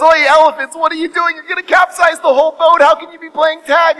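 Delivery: high, loud, exasperated shouting; frantic scolding. Acted. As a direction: tell them off in a panic. Noah scolds the elephants.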